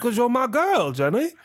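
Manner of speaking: high, plaintive Southern impression; put-on pleading movie-character voice; Forrest Gump. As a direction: imitate a lovesick Southern simpleton. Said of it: "trying to have a Forrest Gump moment".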